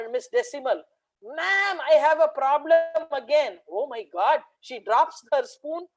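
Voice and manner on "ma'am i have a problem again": put-on high, loud panicked child; panicky boy. Boy rushes to the teacher, high and loud in panic.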